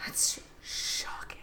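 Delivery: slow, hushed whisper; shocked whisper. Real, not acted. Friend reacting to an unwatched season, slow shocked whisper. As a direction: whisper it slowly, scandalised.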